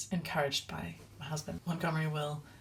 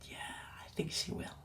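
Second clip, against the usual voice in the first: much softer, whispered reply; son's soft reply; 8 dB quieter than usual. Retold or imitated. Toddler answering mum about Grandma, softly whispered. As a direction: hush it like a little boy agreeing. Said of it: "And he said".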